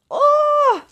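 very high delighted exclamation; excited oh. Real, not acted. Preacher caught up in her own joy, thrilled.

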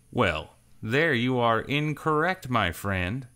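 slow, amused gunslinger drawl; cool drawl. Acted. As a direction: take your time, you own the room.

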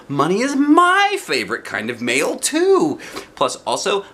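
put-on high, eager little-girl voice; Junie B voice. A narrator's reading. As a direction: chirp it like an excited kindergartner. Junie B character voice.

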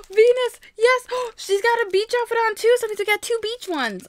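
high, loud delight with a gasp; thrilled, breathless discovery; excited squealing. Real, not acted. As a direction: burst out gleefully, high-pitched. Delighted at the toy she got.

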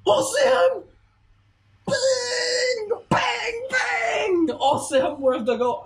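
high screaming cheer; goal celebration shout. Real, not acted.

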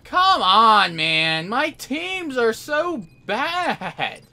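loud protest sliding into a high, drawn-out whine; exasperated whining. Real, not acted. Player complaining about his bad team, exasperated. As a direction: whine it out in real frustration.

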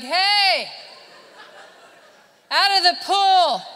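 high, loud holler across the pool; shouting lifeguard. Acted. Lifeguard orders a swimmer out, loud shout. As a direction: call out sharply like a lifeguard. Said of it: "And the lifeguard's like".

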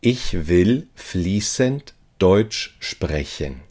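ordinary talk

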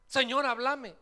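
high, loud plea to the Lord; mock desperate cry. Believer in the sermon begging God to speak, loud high plea. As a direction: shout it up at heaven, begging.

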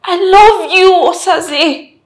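high, loud declaration of love; impassioned confession. Acted. Confessing love to someone who rejects it, loud and impassioned. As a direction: plead it out loudly.

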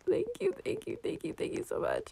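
high, soft, tumbling thank yous; high breathless thanks. Real, not acted. Player thanking a generous trader, breathless high thanks. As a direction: fall over yourself thanking them, almost whispering. Given a free horse.

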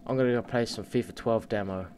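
ordinary talk

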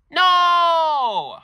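put-on high, loud shrieking child's no; a child screaming in refusal; kid's shrieked no. Kid dreads dad's yelling.

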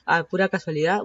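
usual speaking voice

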